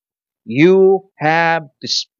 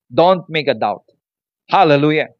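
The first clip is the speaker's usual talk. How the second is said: usual speaking voice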